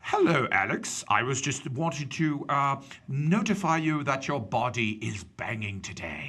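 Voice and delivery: slow, formal, bad British accent. Coworker formally compliments Alex at work, bad British accent. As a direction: do a stiff professional British voice, badly. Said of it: "said it in a more professional manner".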